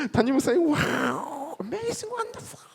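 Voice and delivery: a believer greeting the pastor, squealing in awe; gushing greeting. Pretend. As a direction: gush at the senior pastor in a high squeal. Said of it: "그러지 말고".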